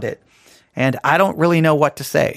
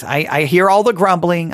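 ordinary talk

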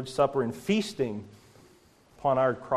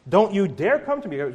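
loud warning with DARE hit hard; stern rebuke; 5 semitones higher, 4 dB louder than usual. Acted. God warning Israel not to approach, loud and stern. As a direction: bark the warning at them as God.

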